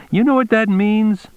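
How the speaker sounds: high-pitched threatening question; menacing cop. A narrator's reading. Cop threatens the cornered suspect.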